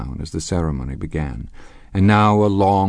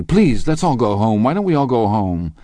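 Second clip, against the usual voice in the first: raised, urgent begging; helpless plea; 5 semitones higher, 5 dB louder than usual. A narrator's reading. Wife wants everyone to leave the quarrel, helpless plea. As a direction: beg them all to leave. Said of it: "she interrupted helplessly".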